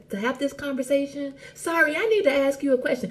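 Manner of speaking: mock the constant sorry-sayer; lightly voiced timid apologizer. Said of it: "Apologizing for every little bitty thing".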